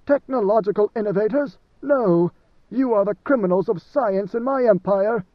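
scornful denunciation. A narrator's reading. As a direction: spit out No! in contempt. Leto denouncing Ixians to Malky.

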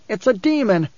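high-pitched alarmed warning; protesting young guide. A narrator's reading. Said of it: "the young guide protested".

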